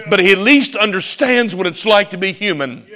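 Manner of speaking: ordinary talk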